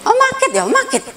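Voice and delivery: put-on high little child lisping sick; child's voice. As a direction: imitate a small child complaining of being sick. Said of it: "Kalau saya sakit, saya langsung".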